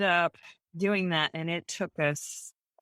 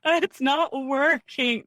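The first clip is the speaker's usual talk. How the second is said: slow, high, louder wail of defeat; despairing whine; 9 semitones higher, 5 dB louder, slower than usual. Retold or imitated. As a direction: whine like all hope is gone. Despairing during crowdfunding campaign.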